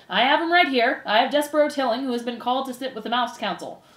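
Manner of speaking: shouted announcement. Acted. Furlow delivers his brother to the council, shouted. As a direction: proclaim it over the crowd. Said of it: "shouted Furlow".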